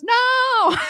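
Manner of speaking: put-on high, loud little-girl no; child's shouted no. Niece admitting she took mom's phone, high shouted no. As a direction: blurt it out like a cheeky kid.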